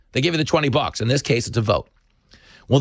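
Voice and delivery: normal talking voice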